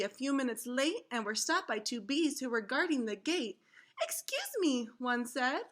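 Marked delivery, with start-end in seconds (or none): 4.0-4.9 high, polite little bee voice; guard bee. Acted. Bee guard stopping kids at the gate, high and polite. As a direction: pipe it up like a small guard bee.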